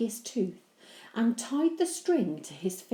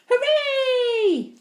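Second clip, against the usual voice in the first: cheer; 11 dB louder than usual. Acted. Henry cheers.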